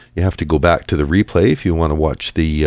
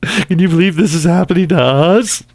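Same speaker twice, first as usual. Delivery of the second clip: mock wailing Haley; 12 semitones higher, 3 dB louder than usual. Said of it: "voicing Haley, mocking".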